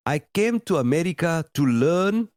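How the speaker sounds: put-on a clumsy try at a Ugandan accent; a bad, mocking imitation of a Ugandan student's accent; terrible Ugandan accent. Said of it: "that's a terrible Ugandan accent".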